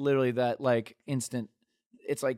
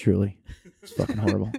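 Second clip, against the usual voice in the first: loud, low-pitched comic voice; hammed-up deep voice; cock voice; 6 semitones lower, 7 dB louder than usual. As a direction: drop deep and belt out the verdict. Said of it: "sounds better in the cock voice".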